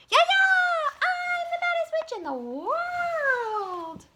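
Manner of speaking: high, loud gleeful witch scream. A narrator's reading. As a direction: shriek it across the playground. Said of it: "Ramona ran screaming".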